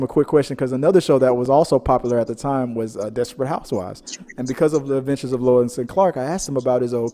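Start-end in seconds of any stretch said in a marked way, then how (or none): none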